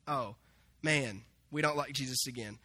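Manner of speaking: low, soft letdown; acting out a crestfallen crowd; deflated. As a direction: sink from cheering to sulky disappointment. Scribes let down after the warning.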